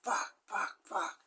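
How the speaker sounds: whispered panicked curse. Retold or imitated. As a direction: whisper it in shock over the body. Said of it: "he's going".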